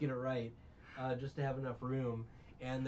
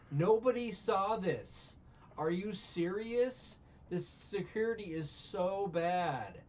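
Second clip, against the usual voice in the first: higher, louder, slowed disbelieving rant; exasperated rant; 5 semitones higher, 3 dB louder than usual. Real, not acted. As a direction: vent real outrage at lax airport security. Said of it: "that was my rant on that".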